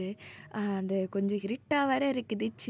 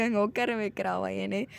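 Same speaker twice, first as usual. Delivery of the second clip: plain reading voice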